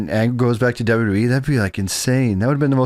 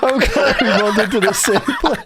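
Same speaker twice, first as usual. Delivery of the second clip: laughing; 9 semitones higher than usual. Retold or imitated. Imitating disguised radio caller.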